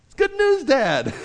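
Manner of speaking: bright, high, forced-cheerful announcement; a pastor voicing a son spinning bad news brightly; mock cheery spin. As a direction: sell the bad news as good news. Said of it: "how you put a positive spin on this".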